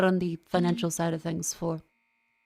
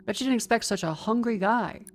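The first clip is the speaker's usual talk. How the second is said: normal talking voice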